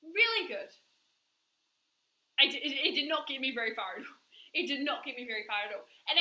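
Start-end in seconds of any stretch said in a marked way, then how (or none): 0.0-0.7 high, bright, put-on cheer; mock breezy denial, much higher; fake cheerful. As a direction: sing it out like nothing is wrong. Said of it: "I spent a lot of time being like".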